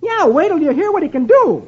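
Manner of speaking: high-pitched proud Dagwood; excited boast. A narrator's reading. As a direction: show off the new pet eagerly.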